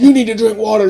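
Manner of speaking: high, loud, insistent offering; mock-bossy hydration coach; nagging partner. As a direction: push the drinks on her. Partner nagging her to drink.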